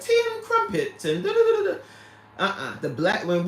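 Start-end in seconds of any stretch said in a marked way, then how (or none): 0.0-1.8 high, prim British caricature; piping mock-British babble; mock British accent. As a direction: imitate British chatter in a high voice. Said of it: "you hear British people speaking and they're like".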